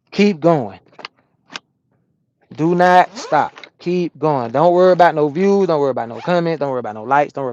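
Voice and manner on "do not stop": slow, every word hit, louder; emphatic slow. Real, not acted. Trucker coaching new YouTubers, slow and emphatic. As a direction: lay down the rule word by word.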